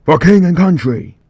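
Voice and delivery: very loud, high-pitched war cry; sergeant's battle cry. Acted. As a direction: scream it as you go over the top. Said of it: "the sergeant yells".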